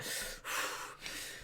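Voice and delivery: imitated heavy exhale of a lifter; heavy breathing. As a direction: puff out air like straining at weights. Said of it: "doing a heavy breathing thing".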